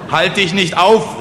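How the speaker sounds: loud, high, drawn-out heckle; calling out. Acted. Someone at the back heckles the speaker, calling out. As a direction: call from the back of a crowd. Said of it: "rief jemand hinten".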